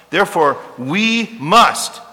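"must" hit loud and lifted, slowed; preacher stressing "must" as he reads the verse. Shown as a demonstration. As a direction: lean hard on "must" with a rising tune. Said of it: "can't emphasize that word enough".

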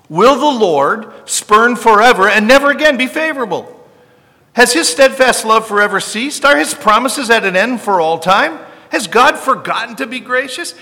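loud, high, desperate cry to God; anguished pleading. Acted. Preacher voicing the psalmist crying out loud to God, anguished pleading.

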